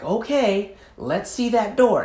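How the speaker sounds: a hint of a slow showy host; lightly voiced host drawing it out; Mother Love. Host calls for the door, lightly voiced, slow. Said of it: "And Mother Love would be like".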